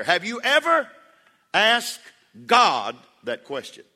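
loud, punched-out question; emphatic challenge. Acted. Preacher putting the question to his listeners, emphatic. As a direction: land each phrase hard, pausing between.

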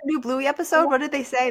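high, quick and eager; excited recall of a cartoon episode. Real, not acted. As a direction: rush to remember the episode.

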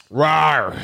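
playful monster rawr; monster roar. Pretend. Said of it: "somebody scare me".

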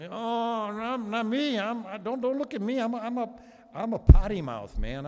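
high, flustered protest of a reluctant prophet; panicky Isaiah. Retold or imitated. Preacher imitating Isaiah refusing God's call, high and panicky. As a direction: squeal out the excuses, backing away. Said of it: "Isaiah going".

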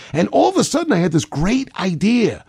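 light up as the idea arrives; sudden great idea. Acted. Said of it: "when else do you have a great idea".

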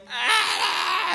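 high mock wail; toddler yelling. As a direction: imitate a child who keeps on screaming. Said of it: "he's still yelling".

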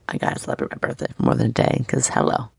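quick breathy whisper, rushed; whispered aside. Real, not acted. Host to listeners, a rushed whispered aside. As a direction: toss it off under your breath, fast.

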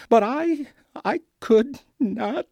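usual speaking voice

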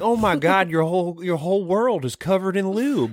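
high-pitched Southern alien; southern accent. Acted. An alien amazed at Earth's water, southern accent. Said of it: "Why do the aliens have a southern accent?".

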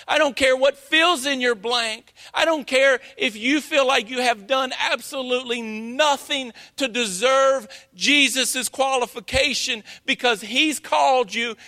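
ordinary talk

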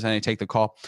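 ordinary talk

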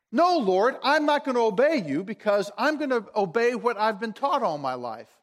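loud, insistent defiance that drops at the end; defiant refusal. Acted. Peter telling the Lord he won't obey, defiant. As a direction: open forcefully, digging the heels in. Acts out Peter defying God.

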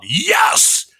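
exultant high, loud "yes"; loud shout. Retold or imitated. Teacher cheering a student's finished weld, loud shout. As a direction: shout it out in triumph. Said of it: "I would just shout really loud".

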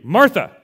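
single loud falling "Martha"; stern rebuke. Shown as a demonstration. Jesus scolding Martha by her name, loud. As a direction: snap out "Martha" once as a rebuke. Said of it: "I would have taken it more like as a rebuke".

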